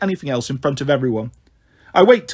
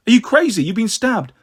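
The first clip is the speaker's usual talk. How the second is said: higher, urgent protest; alarmed disbelief; 6 semitones higher, 3 dB louder than usual. Acted. Alarmed at wounded friend's refusal.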